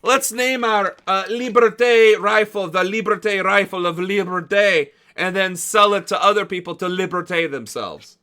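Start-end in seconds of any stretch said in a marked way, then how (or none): 0.0-4.9 bad Latin accent. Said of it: "that was my attempt at a Latin accent".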